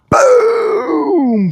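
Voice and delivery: long, high-pitched boom; sudden high "boom" held long; imitated gunshot. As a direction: draw out a high booming gunshot noise. Imitating the rifle shot.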